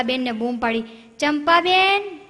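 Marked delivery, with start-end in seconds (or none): 1.2-2.2 long, higher call across houses; calling to neighbour. Retold or imitated. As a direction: stretch the name out to reach next door.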